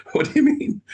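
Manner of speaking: high, loud startled question; startled disbelief. Retold or imitated. Employee stunned by her boss's answer, high and loud. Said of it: "She goes".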